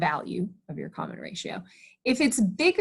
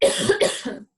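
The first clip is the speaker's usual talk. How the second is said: coughing mid-sentence; cough, then push a word out; coughing fit; 2 semitones higher, 4 dB louder than usual. Real, not acted. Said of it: "sorry, I'm coughing".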